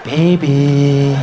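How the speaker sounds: low, sing-song, drawn-out call; horny boyfriend. Acted. Horny man pestering a sleepy partner, drawn-out crooning voice. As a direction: croon it to wake her. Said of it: "horny little rabbit behind you".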